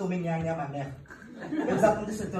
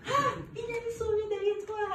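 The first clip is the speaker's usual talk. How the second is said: exaggerated scream of fear; mock fright. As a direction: panic over nothing, hammed up. Mocking scared people.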